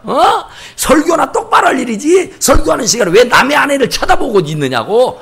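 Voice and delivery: high, indignant mock tirade; scolding. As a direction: imitate a husband telling off the pastor. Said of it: "목사한테 야단을 치는 거예요". (목사에게 야단치는 남편 흉내)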